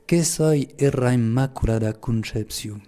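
slow, measured Pyrenean patois; solemn line in Occitan patois; Gascon patois. Shown as a demonstration. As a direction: recite it slowly in Lourdes patois. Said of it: "je vais le dire en patois".

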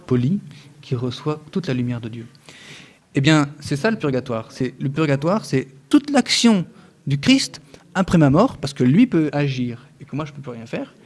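usual speaking voice

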